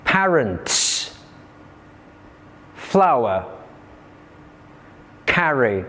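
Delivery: "parents, flower, carry" said PAR-ents, FLOW-er, CAR-ry; "parents, flower, carry", each front-stressed and falling away; first-syllable stress. Shown as a demonstration. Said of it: "the other three have stress on the first syllable".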